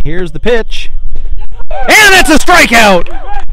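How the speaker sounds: loud building strikeout call; rising to a big shout on strikeout; excited play-by-play. Real, not acted.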